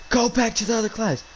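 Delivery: high-pitched imitation of a teacher yelling; mock yell. Teacher sending a student out of class, mock yelling. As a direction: snap it high, without much extra volume. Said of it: "started yelling at me".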